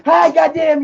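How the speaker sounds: high, loud scalded yelp; imitating dad's yelp. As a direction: yelp like the hot corn just burned his hand. Dad grabbing scalding corn from pot.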